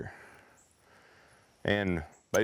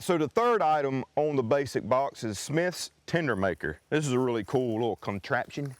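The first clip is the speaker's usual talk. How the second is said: ordinary talk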